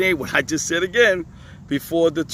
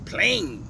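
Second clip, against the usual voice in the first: "plain" pitched up high and pulled up at the end; emphatic repeat; 8 semitones higher, 5 dB quieter than usual. Shown as a demonstration. Teacher insisting the scriptures are plain, stressing "plain". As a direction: hit "plain" high on its own. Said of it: "repeats the word for emphasis".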